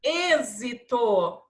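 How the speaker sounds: "Êxito" slow, e-zi-to with a clear z. Shown as a demonstration. Teacher showing pupils X as Z, "Êxito" slowly sounded out. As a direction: stretch every syllable of "Êxito". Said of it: "Som de Z".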